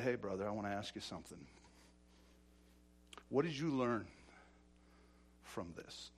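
low, hushed private question; quiet confrontation. Retold or imitated. To a brother caught cheating, quietly probing. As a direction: take him aside, voice dropped right down.

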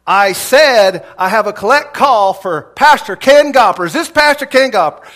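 an impatient operator repeating herself; irritated operator. Retold or imitated. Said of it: "the lady is now irritated".

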